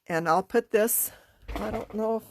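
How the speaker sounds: ordinary delivery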